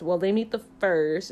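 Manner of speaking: ordinary talk, nothing marked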